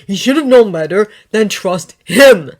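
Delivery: Harry blaming Dumbledore, loud and bitter; angry retort. Acted. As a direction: push back hard on the friend. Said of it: "Harry snapped".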